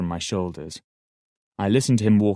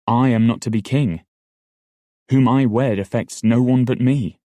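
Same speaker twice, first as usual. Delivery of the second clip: raised, higher voice, hotly insisting; heated defiance; 4 semitones higher, 6 dB louder than usual. Acted. Fitz hotly defying Shade over whom he will wed. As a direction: push back hard, voice climbing and louder.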